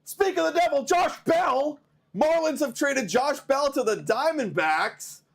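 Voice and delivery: high, excited rush of news; thrilled to break the trade news; real excitement, pitch way up. Real, not acted.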